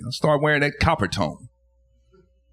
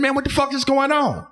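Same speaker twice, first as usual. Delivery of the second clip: shrill high disbelief at the parrots; high outraged question; 12 semitones higher than usual. Retold or imitated. Seeing the plucked female parrot, a high outraged cry. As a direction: pitch it way up in outrage. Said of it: "I said".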